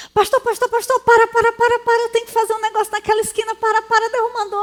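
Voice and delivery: high, fast, pleading cry to stop the car; urgent plea. Retold or imitated. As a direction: beg the pastor to stop right now. Young man begging pastor to stop. (súplica aflita e rápida)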